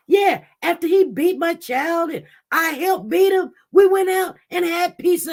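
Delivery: mocking the mother. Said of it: "This woman didn't have problems say".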